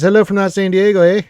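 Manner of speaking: high, loud, mock Canadian accent. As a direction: read the chat in a put-on Canadian accent. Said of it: "Canadian there, eh?".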